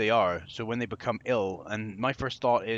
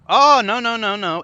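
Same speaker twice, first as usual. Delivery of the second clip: startled cry; 9 semitones higher, 11 dB louder than usual. Real, not acted. Buildings suddenly vanish.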